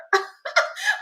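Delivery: very high rising giggle; delighted laugh. Real, not acted. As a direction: squeal of a laugh. Delighted by a viewer comment.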